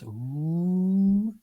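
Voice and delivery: long "ooh" climbing high; drawn-out mock-eerie ooh; mock spooky ooh. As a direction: tease with a ghostly rising "oooh". Teasing the name Hallowed Grounds.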